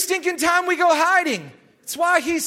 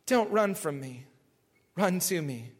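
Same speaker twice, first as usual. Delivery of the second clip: tender plea; 9 semitones lower, 9 dB quieter than usual. Acted.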